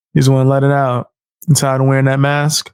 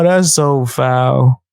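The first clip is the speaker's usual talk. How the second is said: usual speaking voice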